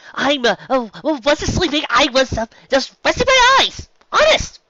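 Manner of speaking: high, loud flustered protest; a caught filly protesting too loudly to her teacher; flustered denial. Acted. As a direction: scramble to insist you weren't asleep. Scootaloo caught sleeping, protests.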